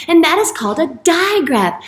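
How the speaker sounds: usual speaking voice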